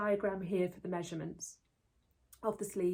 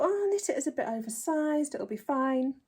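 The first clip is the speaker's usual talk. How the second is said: higher, louder carefree self; mock breezy confidence; 6 semitones higher, 6 dB louder than usual. Knitter mocking her breezy past self, higher and louder. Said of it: "I thought".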